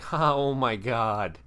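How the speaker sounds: mock stunned laugh, drawn out; awkward laugh. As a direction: draw out a loud, uncomfortable fake laugh. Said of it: "I don't know what to say laugh".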